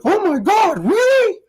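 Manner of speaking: very high, loud squeal of disbelief; hammed-up fake surprise; mock shocked gasp. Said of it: "everyone's like".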